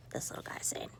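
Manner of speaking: soft whispered aside. Real, not acted. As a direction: confide it to the listener. Hushed aside.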